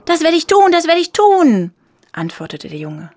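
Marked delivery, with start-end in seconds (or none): 0.1-1.7 put-on high, loud, excited boy; eager shout. A narrator's reading. Boy eagerly promises from the window.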